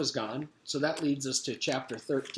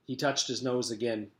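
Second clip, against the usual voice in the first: ordinary delivery, nothing marked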